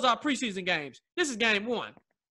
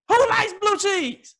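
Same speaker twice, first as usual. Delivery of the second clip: high, slow disbelief, who leaned on; incredulous; 10 semitones higher, 5 dB louder, slower than usual. Real, not acted. Incredulous repeat.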